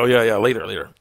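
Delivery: loud farewell. Real, not acted. Calling out a goodbye.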